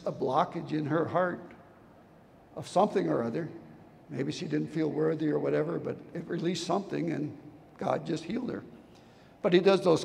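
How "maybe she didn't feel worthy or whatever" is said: usual speaking voice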